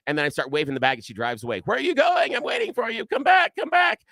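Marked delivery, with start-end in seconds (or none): 1.7-4.0 high-pitched shout after a departing car; calling after someone. Retold or imitated. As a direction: re-enact yelling for them to come back. Calls after woman driving away.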